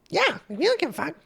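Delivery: high mock child; squeaky careless kid; mocking the kids at the beach as bratty. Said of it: "they're children".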